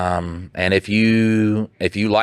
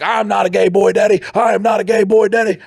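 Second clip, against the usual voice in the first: high, louder mock sobbing whine; hammed-up high crying denial; 11 semitones higher, 3 dB louder than usual. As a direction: wail it higher like a man about to cry. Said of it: "And then I would cry".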